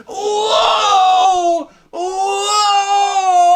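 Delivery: very high, loud wailing whoa; hammed-up scream of alarm; shrieking whoa; mock scream. Slide through the ice rink.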